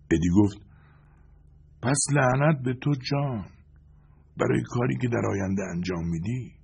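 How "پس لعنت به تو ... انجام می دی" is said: put-on bitter old man. Eddie curses John again.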